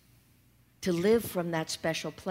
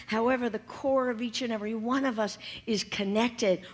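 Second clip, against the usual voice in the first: ordinary talk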